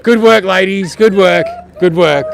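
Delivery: loud cheery call to the balcony. Real, not acted. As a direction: shout up encouragement. Calling out to cheering ladies.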